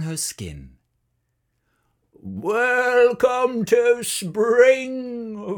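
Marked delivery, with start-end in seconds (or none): 2.1-5.6 high, very slow call; cheerful shout. A narrator's reading. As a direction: call out in welcome. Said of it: "shouted the other sheep".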